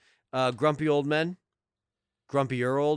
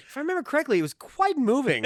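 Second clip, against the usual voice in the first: high, grandly self-pleased recital; pompous poet voice; 11 semitones higher, faster than usual. Retold or imitated. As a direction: boast about your own poem, high and proud. Mocking the poet character.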